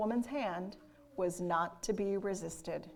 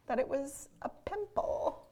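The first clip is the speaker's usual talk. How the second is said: whispered, catching voice; words catching in the throat; breath catch; 4 semitones higher than usual. A narrator's reading. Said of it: "with an unusual catching in his voice".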